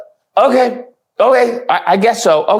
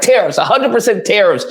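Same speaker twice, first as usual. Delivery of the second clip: ordinary talk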